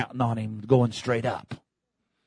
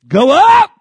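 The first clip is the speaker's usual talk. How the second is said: high, loud go up; commanding shout; 15 dB louder than usual. Acted. God ordering the believer up, shouting. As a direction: command it from above.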